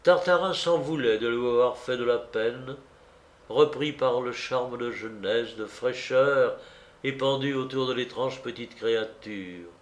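ordinary delivery, nothing marked

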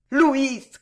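high, loud cry; Juana exclaiming. A narrator's reading. Juana answers the dazzled wounded man, high exclamation. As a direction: cry it out with feeling. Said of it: "clama la petite Juana".

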